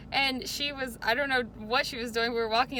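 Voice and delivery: normal talking voice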